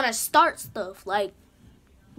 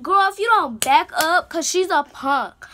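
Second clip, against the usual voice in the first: louder, higher sassy warning; mock telling-off, pitched up and punchy; retold confrontation; 6 semitones higher, 7 dB louder than usual. Retold confrontation with the other girl.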